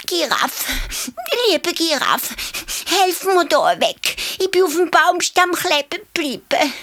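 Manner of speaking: high, pleading little hare voice. A narrator's reading. Hare begs the giraffe to free him, high. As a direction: beg sweetly in a squeaky voice.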